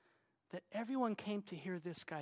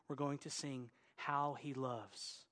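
usual speaking voice

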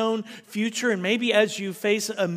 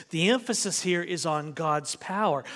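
usual speaking voice

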